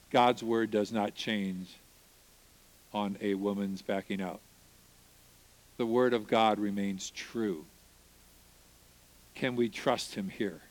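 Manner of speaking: a wife steadying her husband; lightly voiced tender encouragement; gentle reassurance. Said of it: "And my sweet wife".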